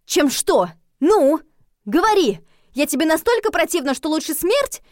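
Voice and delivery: high, loud demanding questions; furious, insistent challenge; angry indignation. A narrator's reading. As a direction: press hard for an answer, offended. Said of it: "шипела я. От негодования и злости".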